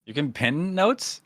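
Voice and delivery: amazed disbelief. Real, not acted. Shocked at pinned notes.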